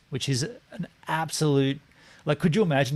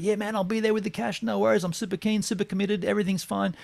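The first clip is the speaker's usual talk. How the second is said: much higher sing-song mock of empty promises; mocking the buyer; 8 semitones higher than usual. Mocking the buyer's promises about the cash, sarcastic. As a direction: parrot his fake eagerness.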